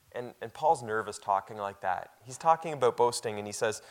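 usual speaking voice

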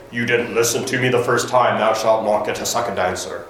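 mock-solemn God in a vague put-on accent; low, pronouncing judgement in an unplaceable accent. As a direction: play a stern God with a dubious accent. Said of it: "I don't know what that accent was".

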